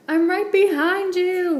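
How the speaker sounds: high, loud eager call. A narrator's reading. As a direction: shout to a friend ahead. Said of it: "Bo called".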